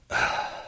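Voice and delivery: breathy sigh after a drink; voiceless sigh of relief; satisfied sigh. Acted. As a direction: let out a refreshed exhale after gulping. After guzzling a long drink.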